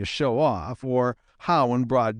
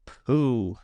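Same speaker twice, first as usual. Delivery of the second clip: dismissive pooh. A narrator's reading. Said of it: "said Peter Rabbit".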